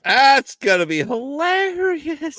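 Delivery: high, slow, stretched-out delight; delighted anticipation. Real, not acted. Friend hearing about the gospel musical role, delighted. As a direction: draw it out high with glee. Friend reacts to casting news.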